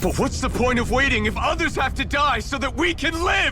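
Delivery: high, heated outburst; angry shout. Acted. Hero arguing with Doc about waiting, angry shouted plea. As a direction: throw the question back at him in anger.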